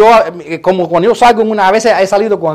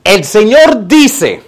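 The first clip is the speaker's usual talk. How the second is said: slow, drawn-out prophetic announcement; prophet's pronouncement; 3 dB louder, much slower than usual. Acted. Prophet announcing God's word to a believer, slow pronouncement. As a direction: intone it slowly like a self-styled prophet. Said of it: "un profeta entra en tu vida y te dice".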